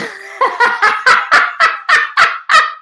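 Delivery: real, high-pitched burst of laughter; loud high laugh. Real, not acted. Host cracking up while signing off to viewers, loud high laughter. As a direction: crack up loudly in a long peal.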